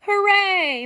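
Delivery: high, loud happy cheer; excited cheer. Acted. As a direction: cheer as the friends arrive. Animals arrive, excited greeting.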